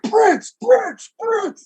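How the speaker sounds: high shouted cheer; crowd cheer. Retold or imitated. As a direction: chant a hero's name from the stands. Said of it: "some of them are like".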